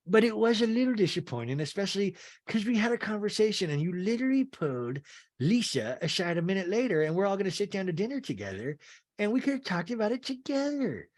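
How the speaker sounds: high, put-on complaining voice; Meredith. Meredith scolds the two women, imitated high complaint. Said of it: "And Meredith goes".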